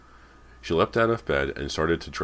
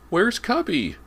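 very high, loud repeated demand; shrill; 6 dB louder, slower than usual. A narrator's reading. Boy still searching for his dog, shrill. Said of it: "James repeated shrilly".

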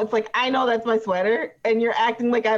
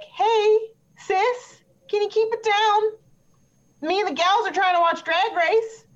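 mock sweet self-quote; 10 semitones higher than usual. Voicing herself asking sister.

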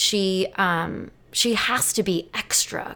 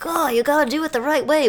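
high, louder preachy mock; 9 semitones higher, 5 dB louder than usual. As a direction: parody a know-it-all. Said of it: "people in the big cities are like".

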